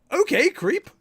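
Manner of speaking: high loud jab; loud mock insult. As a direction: yell a joking insult.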